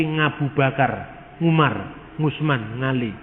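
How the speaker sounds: Javanese speaker saying Ngabu Bakar, Ngali; Javanese accent. Shown as a demonstration. Lecturer imitates how Javanese say Arabic names, mild Javanese accent. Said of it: "Orang Jawa tidak bisa ngomong huruf Ain".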